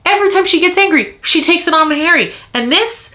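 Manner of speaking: high, fast, heated rant; real fury. Real, not acted. Book vlogger furious at a character, fast high rant. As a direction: rant it out at full outrage. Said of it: "I'm so mad right now".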